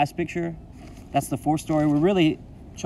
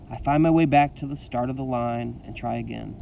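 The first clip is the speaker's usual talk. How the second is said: ordinary delivery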